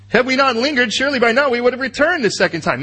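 urgent brothers. Acted. Pastor reads brothers' plea.

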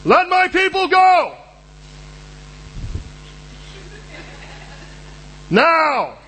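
Aaron bellowing a demand at Pharaoh, in a voice unlike the preacher's own; commanding shout. Retold or imitated. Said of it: "And Aaron goes".

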